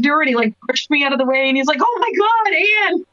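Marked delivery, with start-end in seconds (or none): 1.8-3.0 high, slow, gushing cry of delight; light up like spotting an old friend; excited greeting. Retold or imitated. Rhino spots the speaker's mom at the Christmas party, gushing with delight.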